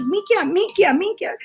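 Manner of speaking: high, sing-out mock repeating; imitated insistent repetition. Retelling how she kept saying the word, high-pitched imitation. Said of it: "she was like".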